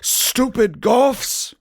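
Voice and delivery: high, drawn-out scornful yell; angry shouting. A narrator's reading. Dumbledore railing at goth students, angry shouting. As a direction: stretch out the insult in a high holler. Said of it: "started shredding at us angrily".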